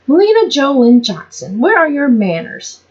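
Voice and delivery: mother scolding. A narrator's reading.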